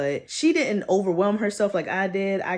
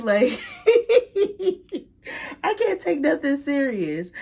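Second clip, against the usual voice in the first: high, helpless giggling; real laughing fit spilling into the words; laughing at herself; 8 semitones higher, 5 dB louder than usual. Real, not acted. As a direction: crack up, then talk high through the laugh. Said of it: "laughing at myself".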